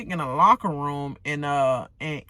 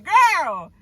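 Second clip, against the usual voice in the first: ordinary delivery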